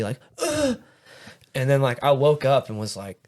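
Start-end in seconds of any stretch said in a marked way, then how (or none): 0.4-0.8 high, strained grunt of air leaving the body; forced-out "ugh", imitating a hard landing; imitated winded groan. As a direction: mimic the breath being knocked out of you. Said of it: "the air leave my body".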